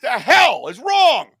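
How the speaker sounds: exasperated. Real, not acted. Ranting about Verizon.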